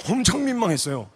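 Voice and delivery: ordinary talk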